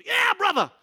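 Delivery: high, loud hearty cry of agreement; fervent shout. Retold or imitated. Oliver answers Marcus's prayer request, fervent high shout. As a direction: boom out a joyful yes.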